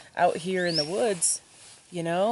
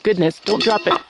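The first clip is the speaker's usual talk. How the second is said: high, loud, bright pet talk; coaxing the dog; 5 semitones higher, 9 dB louder than usual. Real, not acted. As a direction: call the dog over, cheering it on to bring the bowl. Coaxing her dog to pack.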